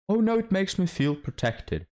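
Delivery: high, breathy-sweet mocking girl voice; mock girly voice. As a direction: parody a woman swooning over a tall man. Mocking women who want tall men.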